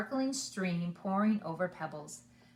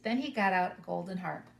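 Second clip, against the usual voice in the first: ordinary delivery, nothing marked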